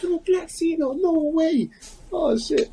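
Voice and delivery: very high, excited fan shriek; mock scream. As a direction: shriek like a starstruck wrestling fan. Fan's shriek continued.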